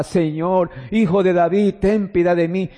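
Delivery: ordinary talk, nothing marked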